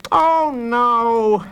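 high, exasperated moan; high-pitched moan of dismay. A narrator's reading. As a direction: groan it high, like a put-out brother. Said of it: "moaned Noah".

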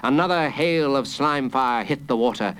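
ordinary delivery

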